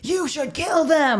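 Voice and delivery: high, stretched-out mock yell; yelling coach. Imitating a coach egging kids on, mock yell. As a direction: draw out a high-pitched pretend holler. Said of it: "like, yelling at the kids".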